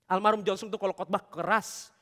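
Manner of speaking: ordinary delivery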